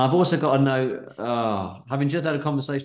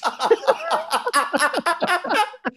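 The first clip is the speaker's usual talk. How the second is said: high laugh; 4 dB louder than usual. Real, not acted. Cracking up mid reading.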